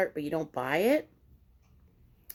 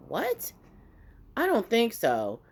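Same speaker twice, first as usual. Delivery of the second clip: high-pitched incredulous "what"; mock astonishment, pitched up; retold disbelief; 5 semitones higher, slower than usual. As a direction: imitate an indignant, disbelieving reaction. Driver reacts to racism report.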